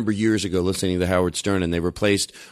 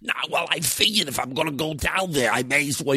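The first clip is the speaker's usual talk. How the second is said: overheard fat man, choked and a little higher; choked fat-guy voice; 4 semitones higher, 3 dB quieter than usual. Acted. Comic imitates someone overheard at the mall, fat-guy voice. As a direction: squeeze the words through a choked throat. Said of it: "That's probably a fat guy".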